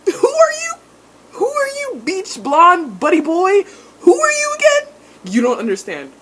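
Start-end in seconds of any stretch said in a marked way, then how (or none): 0.1-4.9 high, loud sneering "who are you"; mocking taunt. Mocking the new guy with a high, loud sneer. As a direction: taunt him in a squeaky mock voice. Mocking Jace's arrival in scene.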